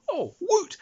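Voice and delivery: high squeal of delight; excited whoop. Real, not acted. Player whooping at the unlimited bar budget, high delighted squeal. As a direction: cheer at the free bar.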